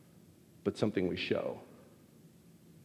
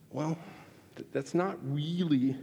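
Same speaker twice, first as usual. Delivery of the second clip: normal talking voice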